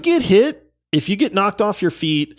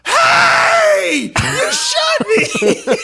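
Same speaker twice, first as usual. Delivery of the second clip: put-on indignant kid; much slower than usual. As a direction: yell it like a seven-year-old who just got shot. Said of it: "And then he just goes".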